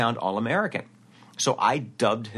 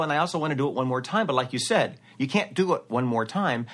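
ordinary talk, nothing marked

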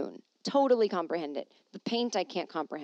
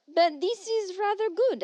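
put-on higher, lightly French-accented read; mock French man; 12 semitones higher, 5 dB louder than usual. She reads a Paris man's quote in mock French.

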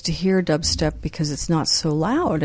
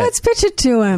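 put-on British accent; 7 semitones higher than usual. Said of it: "our different accents for [name]".

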